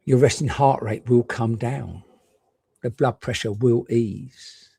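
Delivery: ordinary talk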